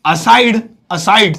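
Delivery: "aside" drawn out slowly, the a sound stressed; slow "aside", not "side". Shown as a demonstration. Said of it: "साइड नहीं". ("aside" धीरे, आगे के a पर ज़ोर)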